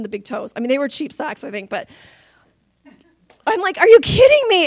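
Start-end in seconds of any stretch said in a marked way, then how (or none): 3.8-4.7 exasperated. Retold or imitated. Exasperated at holey socks.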